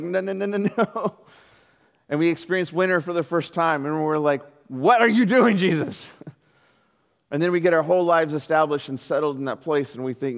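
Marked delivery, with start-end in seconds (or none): 0.0-1.2 mock refusal. Family balks at move to Canada. 4.7-6.1 loud, high comic outcry; mock exasperated protest. Family to Jesus in first winter, mock exasperated protest.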